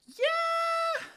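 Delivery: drawn-out, high-pitched squeal of triumph; mock excited cheer. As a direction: imitate thrilled players, loud and very high. Players' gleeful boast, acted out.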